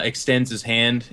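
usual speaking voice

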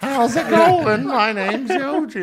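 loud, high, drawn-out put-on greeting; over-the-top slow, high-pitched hello; hammed-up character introduction. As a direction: stretch every word in a big silly character voice.

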